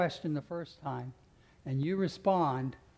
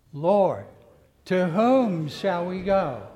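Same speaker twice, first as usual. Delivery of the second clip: "Lord" called out loud, then "to whom" slow and louder; loud declaration; 2 semitones higher, 9 dB louder, much slower than usual. Acted. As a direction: proclaim it slowly to the whole church. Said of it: "The first time we're gonna emphasize".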